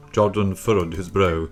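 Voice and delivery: ordinary delivery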